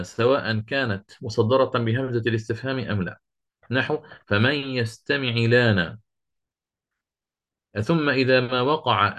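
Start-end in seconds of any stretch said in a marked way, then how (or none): 4.3-6.0 slow, measured tajwid recitation; Quran recitation. Shown as a demonstration. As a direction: recite it slowly like a verse. Quoting Quran verse in recitation.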